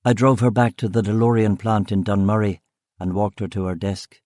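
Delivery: ordinary delivery, nothing marked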